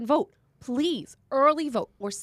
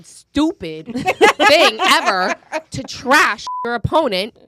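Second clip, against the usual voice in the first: stupid drawn out loud with a laugh; stressed stupid; 2 semitones higher, 9 dB louder, slower than usual. Real, not acted. Host laughing as she stresses stupid on air. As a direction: lean hard on stupid, laughing. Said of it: "I didn't say a bad word".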